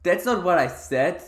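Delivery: outraged at the game's choice of words; loud disbelief. Real, not acted. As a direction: cry out at the game. Game picked a rude line.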